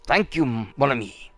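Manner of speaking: put-on a light French accent on the character's lines; lightly voiced French chef, gracious. Player reads the chef's thanks in a light French accent. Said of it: "my French is a little terrible".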